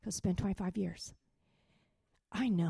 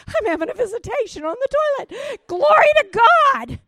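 high, racing cry building to a yell; exultant shout; 16 dB louder, faster than usual. Retold or imitated. Retelling her visitation on the toilet, exultant shout. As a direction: whoop it out in hammed-up rapture.

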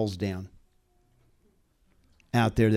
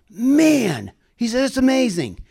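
excited retold exclamation; 11 semitones higher, 5 dB louder than usual. Doctor's excited words retold.

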